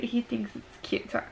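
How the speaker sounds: ordinary talk, nothing marked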